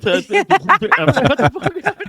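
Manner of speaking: high, slow, laughing shock. Retold or imitated. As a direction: blurt it out in stunned disbelief. Shocked at friend's injured face.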